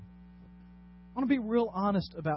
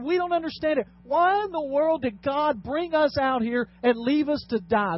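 raised, emphatic protest; acted complaint; 6 semitones higher, 4 dB louder than usual. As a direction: protest like a thirsty, fed-up crowd. Acts out Israelites' complaint to Moses.

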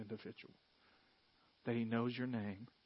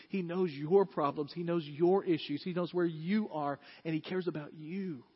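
building, louder preaching that settles at the end; emphatic preaching; 3 semitones higher, 5 dB louder than usual. Acted. Pastor assuring the church God knows them, emphatic preaching. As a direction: drive each line home to the congregation.

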